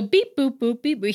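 high robot beeps and boops; playful string of mimicked beeps; little robot noises. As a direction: imitate a child's robot beeping. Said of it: "making little robot noises".

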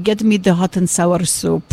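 slow Dracula-style Hungarian father; Hungarian Dracula accent. Retold or imitated. Daughter imitating her father ordering Chinese, slow Hungarian Dracula accent. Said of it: "he sounds like Dracula".

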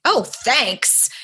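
high-pitched, exaggerated snippy thanks; sarcastic thanks. Old self reacting to an unheld door, sarcastic thanks. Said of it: "Old me would have been like".

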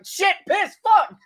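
squawked very high, loud cursing; shouted outburst. Pretend. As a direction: screech out the swear words. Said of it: "a Tourette syndrome chicken".

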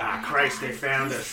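sudden loud panic, caught out; alarmed loud cry. Acted. Hiding in the woods, spotting pursuers, a loud alarmed cry. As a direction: yell it like you've just been found.

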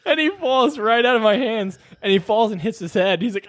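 usual speaking voice

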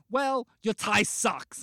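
loud, slow petty insult; pissed off. Retold or imitated. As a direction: throw the insult out loudly. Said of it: "I got pissed off".

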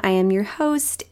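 ordinary talk

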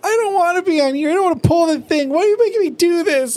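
shrill-free high mock whimper, fast and pleading; whining Rarity. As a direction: whine it out like a spoiled pony. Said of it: "whining sounds like this".

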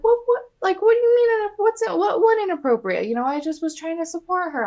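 high, stammering mock disbelief; indignant protest. Jill protesting Dorinda's scolding in a high, indignant voice. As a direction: imitate someone flustered, pitch jumping way up. Acted quote of Jill's startled protest.

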